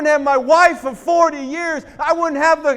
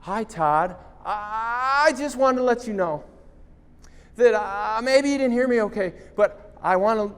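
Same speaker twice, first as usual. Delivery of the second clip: quiet, lower hesitant refusal; Tracy refusing on the phone, softly voiced; lightly voiced Tracy; 6 semitones lower, 7 dB quieter than usual. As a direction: falter like the girl saying no.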